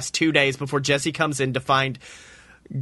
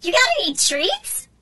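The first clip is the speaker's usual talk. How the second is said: very high squeaky little creature calling; shrill tiny tin-can critter; tiny robot voice. A narrator's reading. Tin can robot tugging Jesse's leg, high squeaky call.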